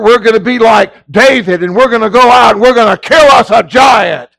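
hammed-up battle cry, stretched at the end; mock hype. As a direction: imitate a rousing pep-talk preacher. Mimicking a hyped-up emotional sermon.

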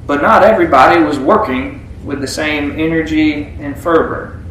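ordinary talk, nothing marked

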